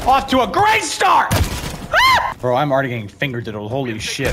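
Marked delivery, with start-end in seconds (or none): 1.3-2.3 startled yelp. Real, not acted. Startled in fight.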